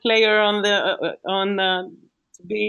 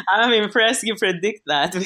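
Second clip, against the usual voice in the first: ordinary talk, nothing marked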